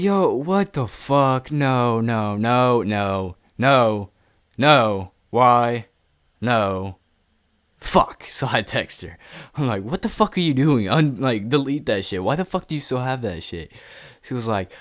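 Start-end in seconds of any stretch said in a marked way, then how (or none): 0.0-8.2 loud, drawn-out disbelief; panicked outburst. Retold or imitated. Reacting to leaked nudes, panicked outburst. 9.8-13.7 higher-pitched, indignant demand; angry confrontation. Retold or imitated. Texting the girl to delete it, angry confrontation. Acts out his angry text to her.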